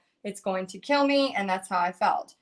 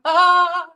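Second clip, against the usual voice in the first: shriek; 8 semitones higher, 13 dB louder than usual. Real, not acted. Reacting to snake in bathtub story.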